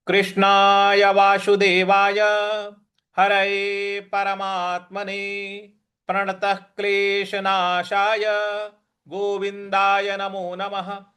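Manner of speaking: steady reverent recitation; recited invocation. Acted. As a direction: intone the Sanskrit salutation evenly. Opening invocation chant.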